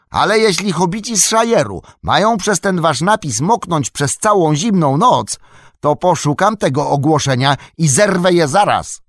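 loud, high threat; angry shout. A narrator's reading. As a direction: shout the threat across the river. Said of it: "odkrzyknął sam". (gniewny, głośny krzyk z groźbą)

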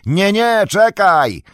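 high, loud urgent call; long, high warning yell; shouting back. A narrator's reading. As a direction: shout to stop someone. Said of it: "odkrzyknął Frodo mocniejszym już głosem".

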